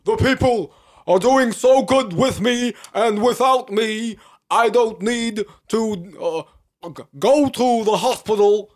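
high, loud stump-speech voice with a put-on accent; Bernie Sanders impression. Podcast host as a senator reassuring the people, in a high, loud impression. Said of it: "That's Bernie".

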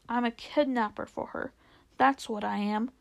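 plain reading voice

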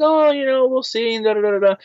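high, sing-song imitation of a waffler; noncommittal brush-off. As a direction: put him off in a high, vague voice. Developer brushing him off, retold.

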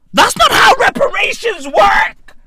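exasperated shout at the absurdity; indignant shout. Real, not acted. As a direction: yell it in outrage. Ranting about Haiti reparations.

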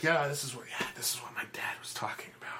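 hushed aside, whispered fast; conspiratorial whisper to a friend. Acted. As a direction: lean in and whisper it quickly.

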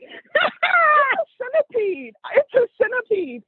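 very high, drawn-out wailing squeal; hammed-up high wail of panic; mock screaming. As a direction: imitate a friend shrieking at a bug. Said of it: "They screamed".

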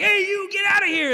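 high, loud threatening yell; mock shout. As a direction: yell at him to get out. Bully with machete chasing pastor.

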